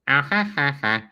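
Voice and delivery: high, quick imitation of a sitcom laugh; Quagmire laugh. Imitating Quagmire's mannerisms, a high laugh. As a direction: do the character's signature laugh. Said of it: "Those are so his mannerisms".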